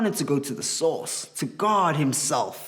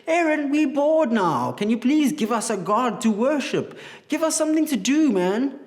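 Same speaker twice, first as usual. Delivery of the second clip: high, whiny, bored plea to Aaron; a high bored kid begging for something to do; bored Israelites; 9 semitones higher than usual. Acted. Israelites begging Aaron for a god, high and whiny.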